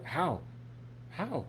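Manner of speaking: high rising "How?"; worried, jumping up in pitch; worried parent asking how; alarmed. Shown as a demonstration.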